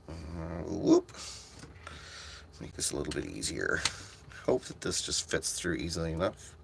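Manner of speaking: very low, soft murmur; absorbed self-talk. Real, not acted. Streamer fiddling with a task on stream, absorbed murmur. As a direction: talk quietly to yourself while concentrating.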